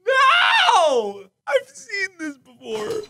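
laughing shout. Real, not acted. Caught laughing at clip.

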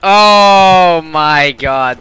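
loud, very slow oh my god; stunned. Real, not acted. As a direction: drag it out in disbelief. Shock at enemy player.

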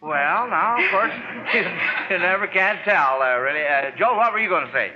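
ordinary talk

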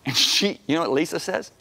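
ordinary talk, nothing marked

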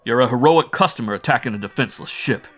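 loud, emphatic telling-off; scolding. Acted. Bull Mike to the captured Martian, scolding. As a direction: scold the attacker at full voice. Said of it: "scolded Bull Mike in very bad Martian".

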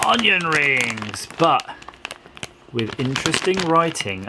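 drawn-out onion rings; slow reveal. Shown as a demonstration. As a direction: stretch it like a grand reveal. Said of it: "drawn-out reveal of product".

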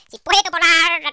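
grito agudo y alargado a lo Chiquito; imitando a Chiquito. Acted. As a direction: chilla estirando las palabras como el cómico. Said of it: "Tienes que hablar como chiquito". (parodia chillona de Chiquito)

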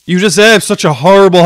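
high-pitched, heated loathing; angry outburst. Real, not acted. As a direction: let real anger push the voice up. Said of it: "I'm so fucking angry".

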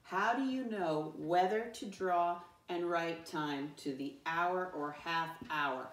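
measured choral-reading pace; every word given room, deliberately; slow read-along. Shown as a demonstration. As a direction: read slowly so a class can join in. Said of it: "Read it with me".

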